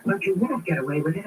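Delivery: ordinary talk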